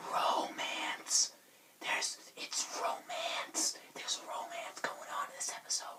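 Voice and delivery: breathy secretive whisper about romance; teasing whisper. Pretend.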